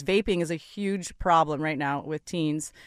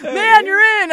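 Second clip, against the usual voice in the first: much higher, louder mock bro cheer; cheering buddies; 14 semitones higher, 14 dB louder than usual. Friends cheering their buddy, a high mock bro voice. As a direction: play the egging friends. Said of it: "going like".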